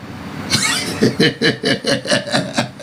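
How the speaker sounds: long run of cackling laughter; amused laugh. Real, not acted. Vlogger cracking up at his own warning, amused laugh. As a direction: laugh at length at your own joke.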